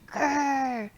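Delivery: light playful grr grr; bear grr. Acted. Teacher growling along in the song, mock grr. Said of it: "you're gonna go, Grr".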